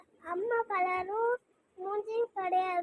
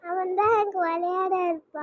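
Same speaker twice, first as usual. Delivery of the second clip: raised voice, making the point firmly; louder repeat; 7 dB louder than usual. Real, not acted. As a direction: say it louder so it gets heard. Repeats louder after parent's "ah?.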